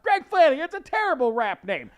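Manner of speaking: loud, high, scornful proclamation; pompous big shot. Retold or imitated. Big shot renaming a rapper, loudly pompous. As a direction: pronounce the verdict with grand certainty. Boastful audition character dismissing a name.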